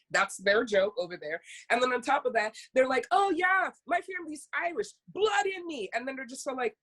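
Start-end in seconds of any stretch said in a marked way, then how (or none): 3.1-5.9 high-pitched mock tourist gushing; mock-eager claim of Irish roots; boastful tourist impression. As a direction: imitate a clueless tourist, voice pitched up.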